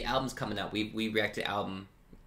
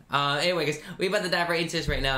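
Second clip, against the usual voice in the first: excited; 6 semitones higher, 5 dB louder than usual. Real, not acted. Hyped vlog intro.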